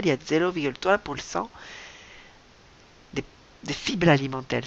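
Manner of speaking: "trois pour cent" said as "twa pul sent", R dropped or turned to L, a bit fast; mock accent turning R into L in "fibres alimentaires"; comic accent. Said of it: "j'ai pris un accent tout d'un coup".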